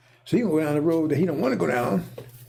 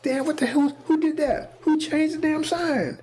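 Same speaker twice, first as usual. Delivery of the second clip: much higher, heated retold rant; angry outburst; 11 semitones higher than usual. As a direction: blow up at whoever changed the sign. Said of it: "He's angry".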